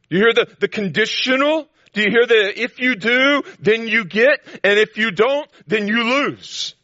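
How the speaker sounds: high and loud, hammering the promises; emphatic preaching. Acted. Preacher to the congregation, insisting high and loud. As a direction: drive home every "I will".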